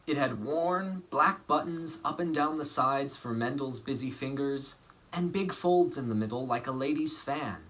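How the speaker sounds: lively picture-book reading voice; story narration. A narrator's reading. Actor reading a picture book to children, lively storytelling. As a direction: read it warmly to children.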